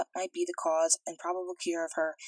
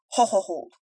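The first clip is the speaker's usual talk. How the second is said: a louder, half-hearted roar to interrupt; mild roar; 5 dB louder than usual. A narrator's reading. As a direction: cut the other man off, a touch loud. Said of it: "roared Humdrum".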